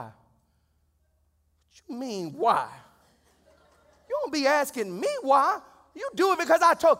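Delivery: loud, high-pitched mock scolding; hammed-up parental indignation; outraged parent. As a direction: erupt at a child who dared to ask why. Voices outraged parent answering questioning daughter.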